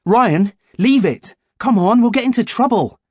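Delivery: high, loud, worried plea; urgent warning. A narrator's reading. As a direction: plead urgently, afraid.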